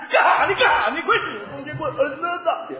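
put-on loud, high Northeastern Chinese accent singing. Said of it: "东北话唱出来".

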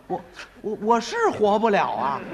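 ordinary delivery

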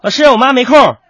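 high-pitched schoolkid voice; pupil's protest. Acted. Pupil explaining to teacher why mother is absent, high protest. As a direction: pipe up high like a pupil making excuses.

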